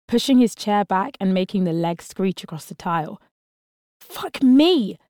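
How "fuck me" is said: sudden loud curse, pitched up; startled yell. Acted. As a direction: blurt it out in fright. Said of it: "Harvey yelled".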